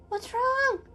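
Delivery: high called question; calling up. Acted. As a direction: call up to him.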